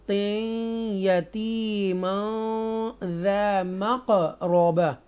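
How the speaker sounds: slow, drawn-out tajweed chant; Quran recitation. Shown as a demonstration. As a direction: recite each word slowly and melodically. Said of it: "इस तरह हम अदा करेंगे".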